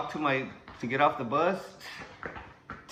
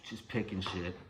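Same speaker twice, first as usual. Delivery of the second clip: ordinary delivery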